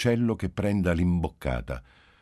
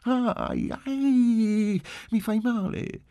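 put-on very high child's wail; little girl's high-pitched put-on ouch; shrill feigned pain; 4 dB louder, much slower than usual. A narrator's reading. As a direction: whine piercingly, faking the hurt. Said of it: "con voce acuta, fingendo dolore".

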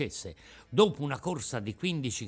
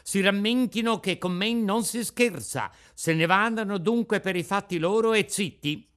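bold, raised challenge; shouting; 7 semitones higher, 4 dB louder than usual. Retold or imitated. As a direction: shout it out, playing brave. (gridando con spavalderia)

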